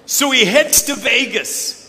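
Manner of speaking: usual speaking voice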